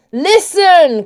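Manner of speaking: high, loud impatient call; frustrated cry. A narrator's reading. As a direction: cry out with frustration breaking through. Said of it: "frustration beginning to override the honey".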